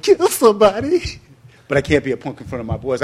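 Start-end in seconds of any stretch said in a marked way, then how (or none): 0.0-1.2 much higher, louder and slow, stunned; shocked. Retold or imitated. Scared teen hearing he must kill someone, shocked high squeak. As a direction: gasp it out in disbelief. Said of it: "I'm like".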